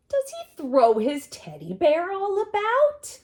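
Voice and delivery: animated read-aloud; dramatic storytime question. Acted. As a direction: ham it up for the kids.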